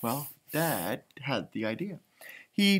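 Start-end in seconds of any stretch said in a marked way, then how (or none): none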